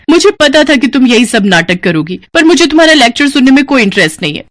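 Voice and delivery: mock her mother's lecture; sarcastic outburst. Daughter mocks mother's lecture, sarcastic and sharp.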